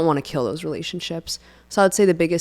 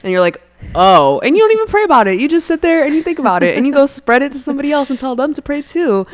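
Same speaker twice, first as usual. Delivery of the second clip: high and loud, worked-up mocking rant; ranting about prayer-request gossip, high and loud; laughing rant; 9 semitones higher, 7 dB louder than usual. Real, not acted. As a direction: pile it on with rising outrage and laughter.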